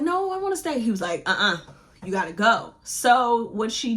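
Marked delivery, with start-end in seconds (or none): 0.0-0.8 high, pleading no; pleading protest. Acted. Heroine pleads to stay home. 1.3-2.7 firm refusal. Acted. Rhys firmly refuses her.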